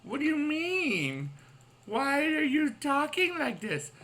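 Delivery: high, slow, baffled put-on voice; mock-bewildered high voice; imitating a puzzled viewer. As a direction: play a confused fan squeaking questions. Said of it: "some of you are wondering, like".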